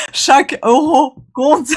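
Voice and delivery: Chaque euro compte said slowly through real laughter; giggling through the line, can't keep it straight; laughing. Real, not acted. Retaking her line and breaking into giggles, laughing. Cracking up over repeated take.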